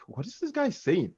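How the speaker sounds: high-pitched mock bafflement; retold bewildered question; puzzled son. Retold puzzled son's reaction.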